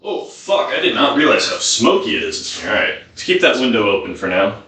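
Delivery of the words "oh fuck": high breathy oh fuck; startled whisper. Real, not acted. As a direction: gasp it out in alarm. Notices smoke.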